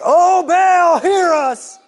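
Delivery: high desperate shout to Baal; shouting prophets. Acted. Prophets shouting to Baal, desperate high shout. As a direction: cry out to an idol that won't answer.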